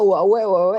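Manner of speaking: loud comic vomiting sounds; imitated gagging; mock retching noise. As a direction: retch it out for a laugh. Mimicking being sick.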